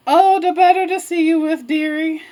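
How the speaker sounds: high, singsong fake granny; wolf posing as grandma. Wolf answering Red about big ears, sly granny voice. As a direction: raise the pitch and coo it like Grandma.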